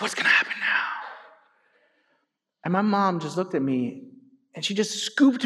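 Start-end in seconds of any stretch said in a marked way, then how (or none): 0.0-0.8 put-on whispered child's dread; hushed, frightened whisper; scared whisper. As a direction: whisper it like a kid caught out. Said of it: "So I'm like".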